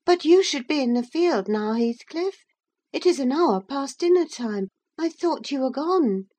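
put-on high-pitched girl's character voice; young Catherine. A narrator's reading. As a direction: pitch it well up for Catherine questioning Heathcliff. Catherine speaks to Heathcliff.

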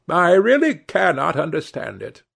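put-on high-pitched old-lady protest; mildly exasperated old lady; exclaiming. A narrator's reading. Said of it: "exclaimed the old lady".

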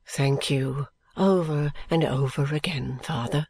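low, slow, earnest son; grateful son. A narrator's reading. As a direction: thank your father with deep respect.

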